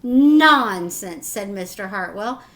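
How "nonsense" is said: firm dismissal. A narrator's reading. Father brushing off her worry.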